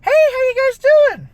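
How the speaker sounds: very high, loud, gushing hello; friendly passerby. Acted. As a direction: call out an over-friendly greeting to strangers. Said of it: "everybody that I passed by was like".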